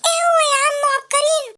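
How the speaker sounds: ordinary talk, nothing marked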